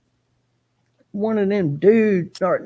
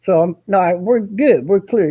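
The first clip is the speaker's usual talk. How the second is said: usual speaking voice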